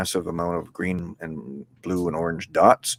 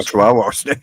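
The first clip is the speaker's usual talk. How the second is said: laughing; 6 dB louder than usual. Real, not acted. Amused at the snake's name.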